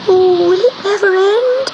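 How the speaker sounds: high, stretched-out little cat wail; small storm-tossed kitten, very high; little cat's voice. A narrator's reading. Cat tossed about in a storm, high plaintive voice. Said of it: "thought Gobelino as he rolled".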